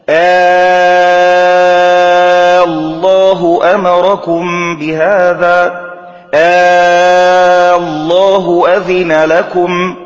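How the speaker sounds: slow, sung recitation with a long "aaa" opening each الله; Quranic-style chant, hugely lengthened opening آه; questioning hamza. Shown as a demonstration. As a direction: chant it loudly, drawing out the first vowel of الله. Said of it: "كقول الله".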